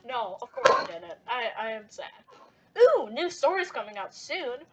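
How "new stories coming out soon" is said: plain reading voice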